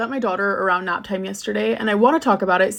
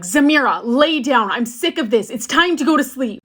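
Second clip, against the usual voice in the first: a higher, louder yell at the daughter; retold yell; 5 semitones higher, 4 dB louder than usual. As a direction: snap at a toddler who won't nap. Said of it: "and I yelled".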